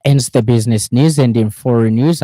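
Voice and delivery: loud, bright segment announcement; punchy radio newsreader link; news transition. Acted. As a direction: announce the new section firmly.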